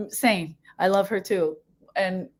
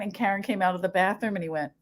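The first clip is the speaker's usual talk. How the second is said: normal talking voice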